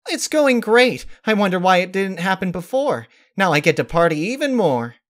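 high, bright and bouncy pony; bubbly Pinkie voice. A narrator's reading. Pinkie answering Rarity, high and bubbly. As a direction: play her upbeat and eager.

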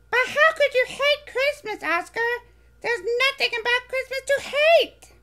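high, bright little furry monster voice, eager; squeaky childlike monster, proud; Elmo voice. Acted. Elmo pleading with Oscar.

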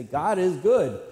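loud, high call to a class; imitated director's call. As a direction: open class with a ringing call.

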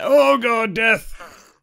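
sudden high, loud alarm; startled cry. Real, not acted. Player startled by death in the game, alarmed. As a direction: cry out as danger jumps at you.

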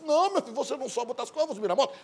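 high, mocking female retort; mock the guy in a high voice; girl's mocking reply. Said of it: "Ela disse".

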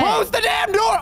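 real high scared shout; frightened yell. Real, not acted. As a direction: yell in a panic at a teammate. Scared by a monster.

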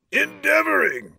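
high, loud outraged tyrant; fierce cry. A narrator's reading. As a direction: throw the word back fiercely. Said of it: "cried the tyrant fiercely".

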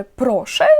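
"проше" as a polite pardon-me question; questioning intonation. Shown as a demonstration. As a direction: ask "проше" as if you didn't catch it. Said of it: "із такою інтонацією".

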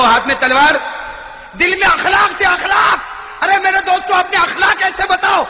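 high, loud exhortation; preacher urging his listeners to good manners, shouted; preaching at full force. Real, not acted. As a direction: drive the point home, shouting.

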